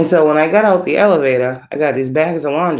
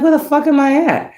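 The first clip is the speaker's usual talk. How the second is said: baffled; 10 semitones higher than usual. Retold or imitated. Confused in strange dream building.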